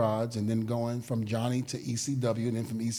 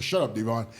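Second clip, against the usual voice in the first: laughing retort; 4 semitones lower than usual. Retold or imitated. Bubba's retort retold.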